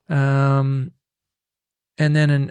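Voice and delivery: usual speaking voice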